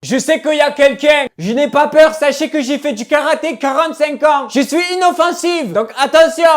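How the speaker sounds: loud shout, fake-brave threat at an intruder; scared bravado. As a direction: yell to sound tough while terrified. Menace un intrus, peur.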